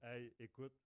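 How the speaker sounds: ordinary delivery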